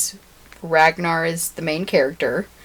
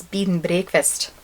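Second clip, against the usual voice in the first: bed and breakfast with Scottish vowels, like "beed n brehkfist"; badly faked Scottish, bed said as bead; Scottish accent; 3 dB quieter than usual. Shown as a demonstration. Showing a phrase learned in Scotland, Scottish-accented bed and breakfast. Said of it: "I learned these two things".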